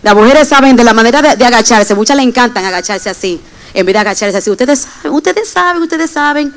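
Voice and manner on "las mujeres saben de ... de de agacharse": normal talking voice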